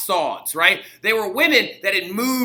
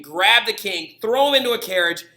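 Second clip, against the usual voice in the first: ordinary delivery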